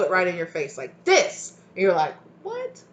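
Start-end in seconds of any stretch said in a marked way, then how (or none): 2.4-2.8 very high squeaked "What?"; mock stunned disbelief; high startled yelp, hushed; shocked what. Listener shocked by blunt words.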